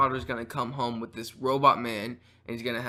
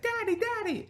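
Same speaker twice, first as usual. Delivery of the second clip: put-on little boy. Little boy calling his dad.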